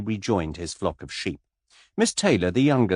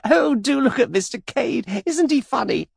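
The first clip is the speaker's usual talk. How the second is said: laughing squeal; 4 dB louder than usual. A narrator's reading. Miss Taylor laughing at Mister Cade, high giggly squeal. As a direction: shriek with delight. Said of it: "screamed with laughter".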